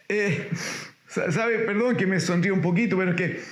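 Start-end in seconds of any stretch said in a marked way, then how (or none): none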